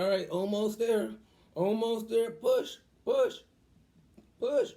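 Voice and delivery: high, loud birth coaching; retold doctor urging. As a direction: call out encouragement like a delivery doctor. Doctor urging during birth, acted.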